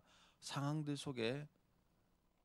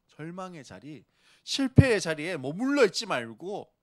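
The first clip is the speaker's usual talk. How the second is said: louder, higher, forceful Jesus voice; Jesus booming his reassurance; bold Jesus; 4 semitones higher, 11 dB louder than usual. Retold or imitated. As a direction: proclaim it like a strong Jesus beating his chest. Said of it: "예수님이 갑바가 있다고 한번 생각해 보세요".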